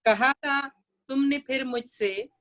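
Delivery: measured poetry reading with long pauses; unhurried, expressive verse delivery; nazm recitation. Acted. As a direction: recite the poem phrase by phrase, pausing between them.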